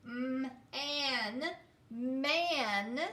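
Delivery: M, an, man split then blended; sounding out. Shown as a demonstration. Teacher sounding out man for the class. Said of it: "let's do the last three".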